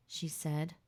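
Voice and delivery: ordinary delivery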